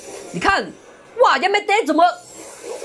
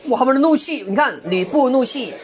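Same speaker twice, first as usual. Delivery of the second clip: ordinary talk, nothing marked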